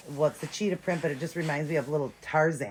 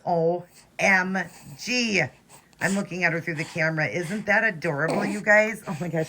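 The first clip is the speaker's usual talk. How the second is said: louder and higher, squealing over how cute she is; excited gushing; 4 semitones higher, 7 dB louder than usual. Real, not acted. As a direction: gush with delight at the doll. Gushing over finished doll.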